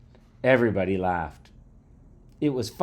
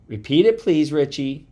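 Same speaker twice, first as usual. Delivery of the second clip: higher, louder teacher voice; teacher's request; 7 semitones higher, 8 dB louder than usual. A narrator's reading. Teacher to mumbling pupil, raised-voice request. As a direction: ask firmly, pitched up.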